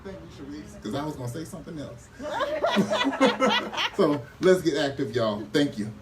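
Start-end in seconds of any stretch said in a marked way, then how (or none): 2.2-3.9 a sudden high laugh, half embarrassed; high burst of laughter. Real, not acted. Speaker laughing at herself after thanking Sharice, a high laugh. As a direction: laugh out loud at yourself.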